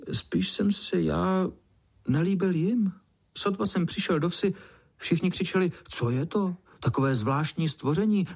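high-pitched, disappointed small voice; rueful little ant. A narrator's reading. Little ant admits nobody liked him, disappointed. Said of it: "zakoulil očkama a vzdychl".

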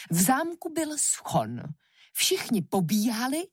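plain reading voice

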